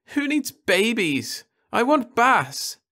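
high, slow pouting whine; sulky girl. A narrator's reading. Daughter sulking that she only wants Bass, high and slow. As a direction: sulk like a child.

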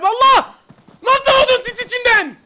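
high, loud furious disbelief; outraged shout. Real, not acted. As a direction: yell like you're losing it. Shot through smoke, outraged. (öfkeyle bağırarak, çileden çıkmış)

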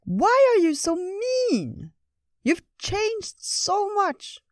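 very high, slow, reproachful squirrel voice; squeaky squirrel. Acted. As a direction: squeak it like a hurt little squirrel. Squirrel Ratatosk speaks.

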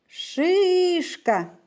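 Шишка called out high, first syllable ШИ stretched and stressed; calling out the word. Shown as a demonstration. Teacher calling the word to find its stressed syllable, stress on ШИ. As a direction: call out Шишка as if summoning it, leaning on ШИ. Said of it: "Ну, ми покличемо".

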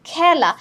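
খেলা with open "æ", as khæla; open vowel. Shown as a demonstration. Teacher gives another example, open vowel. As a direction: stress খেলা with the vowel of "cat". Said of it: "এখানেও এ এর মতো উচ্চারণ".

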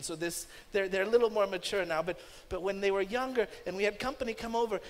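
ordinary talk, nothing marked

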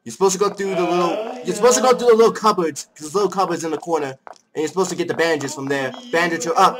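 high, fast, insistent explaining; exasperated instructing. Real, not acted. Player telling co-player how to save her, exasperated.

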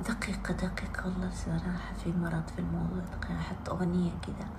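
soft whispered aside; a hushed whispered aside. Real, not acted. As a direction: whisper it to herself, fast at the end. Whispers while choosing a song.

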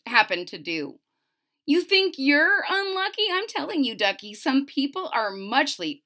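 lively, bouncy read-aloud of rhymes; storybook narration. A narrator's reading. Reading a rhyming book to a child, lively narration.

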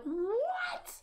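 very high, drawn-out disbelieving "what"; long high-pitched retold shock; retold incredulous squeal. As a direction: shoot way up high in mock outrage. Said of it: "I'm like".

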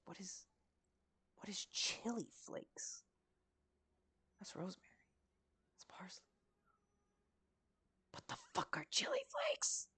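urgent whisper, rising in pitch as the confusion grows; frantic whisper. Real, not acted. Cook searching the shelf, frantic whisper. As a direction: hiss the question in baffled panic. Hunting for an ingredient.

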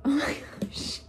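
breathless whispered awe; excited whisper. Real, not acted. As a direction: whisper it, stunned with joy. Overwhelmed by the new plant.

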